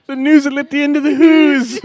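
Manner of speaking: "noozle" and "who's" with hooting oo, high and loud; comic squealed "noozle", rhymed with "who's"; silly mispronunciation. Pretend. Repeats the silly word, cracking up.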